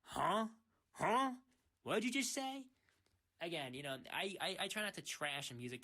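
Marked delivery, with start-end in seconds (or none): 0.0-2.6 high, loud confused "huh?"; mock bafflement. Listener who can't understand rap lyrics, exaggerated puzzled questioning. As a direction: pitch it up like you caught nothing.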